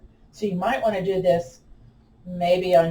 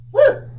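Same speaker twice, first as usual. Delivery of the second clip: excited woo; 6 dB louder than usual. Real, not acted. Exertion cheer mid exercise.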